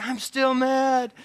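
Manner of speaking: high, slow, drawn-out grumble; mock still mad. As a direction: stretch it out like a sulking kid. Voicing anger after praying.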